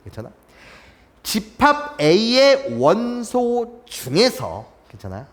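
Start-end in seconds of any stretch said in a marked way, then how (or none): 1.2-4.7 slow and a little higher; careful teacher reading; slow demo reading. Shown as a demonstration. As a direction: lay the phrase out for the class. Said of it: "집합 B의 차집합이라고 읽어요".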